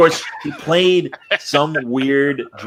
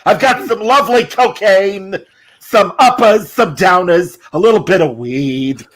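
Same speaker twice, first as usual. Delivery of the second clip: high, loud, drawn-out comic dealer pitch; Gilbert Gottfried; 8 semitones higher, 5 dB louder, slower than usual. Acted. Big Chicken offering drugs for sale, Gottfried impression. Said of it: "they mentioned on Gilbert Gottfried".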